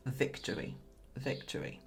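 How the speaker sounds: breathy whispered chant; whispering. Real, not acted. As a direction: whisper it like a secret win. Dramatic hushed repetition of victory.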